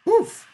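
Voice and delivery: put-on very high, loud grunt of an old person; pained high-pitched "oof"; reedy elder's oof. A narrator's reading. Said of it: "a reedy, high-pitched one".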